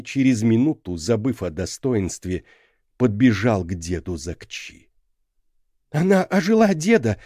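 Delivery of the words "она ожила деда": high, loud and breathless with hope; breathless eager child. A narrator's reading. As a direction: gasp it out like an eager little boy. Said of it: "задыхаясь, спросил он со свойственным детям пылом и надеждой".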